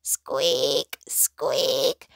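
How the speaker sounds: thin creaky squeak; little creaking squeaks; squeaking lantern. A narrator's reading. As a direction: imitate a swinging lantern's squeak. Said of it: "went the lantern as it swung".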